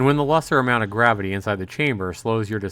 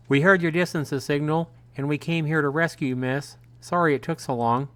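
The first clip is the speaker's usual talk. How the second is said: higher-pitched rescuer's voice; character voice, raised above the narration; older male rescuer; 6 semitones higher than usual. A narrator's reading. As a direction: pitch it up, explaining to the person being rescued. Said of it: "The older sounding of the two men explains".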